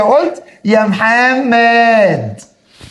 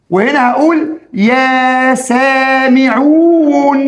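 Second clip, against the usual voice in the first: يا سامعون drawn out very slowly; slow call; 3 semitones higher, much slower than usual. Shown as a demonstration. Teacher contrasting the second call slowly. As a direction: summon them slowly with يا سامعون. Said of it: "التانية".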